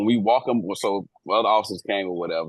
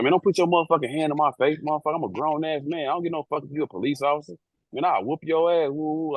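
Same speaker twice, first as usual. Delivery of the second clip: put-on higher, heated retelling of a drunk's threat; a touch of mock drunken rage; drunk man's threat; 7 semitones higher than usual. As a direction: play the drunk passenger squaring up, pitched high. Drunk man yelling at officer.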